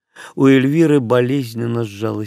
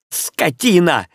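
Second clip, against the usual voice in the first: выше, резко бросая оскорбление; выпалить обиженно и зло; гневный выкрик; 6 semitones higher, slower than usual. Acted. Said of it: "крикнула ему Эльвира". (Эльвира в ответ на оскорбление, гневно)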